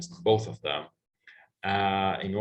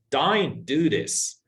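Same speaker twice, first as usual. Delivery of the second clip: slow Australian Don't do this with a wide vowel; drawn-out Australian vowels; Australian accent; 6 semitones higher, 5 dB louder, much slower than usual. Shown as a demonstration. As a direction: demo the Australian accent slowly. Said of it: "an Australian, uh, speaker might say".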